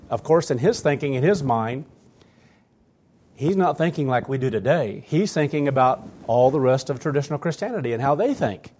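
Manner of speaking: normal talking voice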